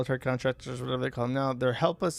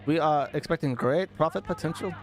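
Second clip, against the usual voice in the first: put-on hammy Indian accent; formal address in a mock Indian accent. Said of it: "Should have read that in an Indian accent".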